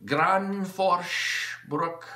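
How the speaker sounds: Granforsbruck said slowly and carefully the Swedish way; Swedish pronunciation. Shown as a demonstration. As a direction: sound out Granforsbruck slowly like a Swede would. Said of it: "That's how you say it in Sweden".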